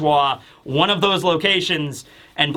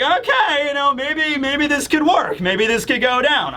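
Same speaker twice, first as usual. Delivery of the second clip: much higher, hopeful and jittery; pitched-up anxious optimism; nervous hope; 8 semitones higher than usual. Retold or imitated. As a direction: talk yourself into believing it'll work. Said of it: "And it's like".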